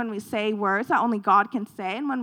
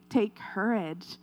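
soft urging; 3 semitones lower, 7 dB quieter, slower than usual. Shown as a demonstration. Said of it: "So I'm telling you today".

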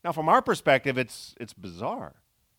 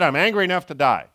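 loud, furious outburst; loud angry retort; 3 semitones higher, 9 dB louder, faster than usual. Retold or imitated. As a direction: snap it back at God in anger. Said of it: "I mean, he's angry".

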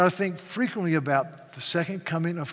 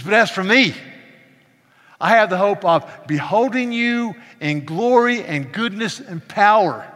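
fervent preaching; 4 semitones higher, 8 dB louder than usual. Acted. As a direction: declare it to the congregation. Preaching psalm's hope.

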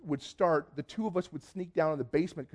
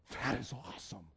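very soft, lower, amazed; hushed awe; 4 semitones lower, 12 dB quieter than usual. Retold or imitated. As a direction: barely voice it, as if overawed. Awed response to testimony.

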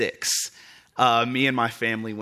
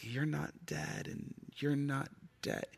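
put-on almost voiceless child, slow and small; lost kid mumble; 16 dB quieter, slower than usual. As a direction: mumble it like a scared little boy. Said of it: "I'm like".

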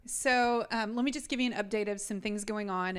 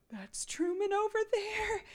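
much higher, slow realization; pitched-up shocked discovery; sudden recognition; 11 semitones higher than usual. Retold or imitated. Mom spotting her lost son by the pharmacy, high and startled. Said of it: "And I'm like".

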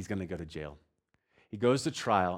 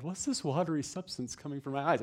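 Seinfeld scene impression; 6 semitones higher than usual. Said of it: "the scene at Seinfeld".

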